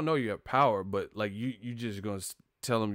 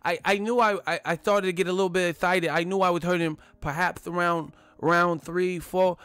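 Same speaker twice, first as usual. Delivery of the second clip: high, louder Tyson voice, getting excited; Mike Tyson impression; 5 semitones higher, 4 dB louder than usual. Imitating the champ proudly telling the interviewer. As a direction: tell it like a gleeful champ. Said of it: "He was like".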